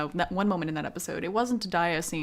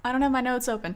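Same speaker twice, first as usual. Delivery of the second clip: normal talking voice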